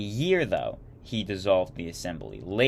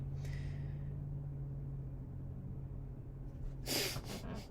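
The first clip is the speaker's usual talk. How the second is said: soft laugh; 4 semitones higher, 14 dB quieter than usual. Real, not acted. Laughing at own habit.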